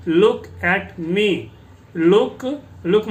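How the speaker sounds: very slow, word by word; slow model reading. Shown as a demonstration. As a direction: drag look at me out for the class. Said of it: "teacher models the English phrase".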